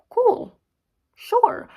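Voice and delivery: squeaky high sarcastic "cool, sure"; mock agreement. To the viewers, mocking her own plan, high sarcastic agreement. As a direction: chirp it back as a fake yes.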